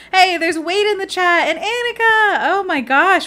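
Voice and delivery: high, loud burst of delight; real excited shout of welcome; excited greeting. Real, not acted. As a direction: call out a thrilled hello. Excited greeting of chat arrivals.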